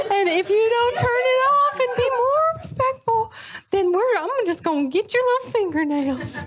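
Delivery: high-pitched mock monster wail, breaking into laughs; haunt the speakers in a silly high voice; fake Goosebumps monster. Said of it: "a fake, like, Goosebumps monster".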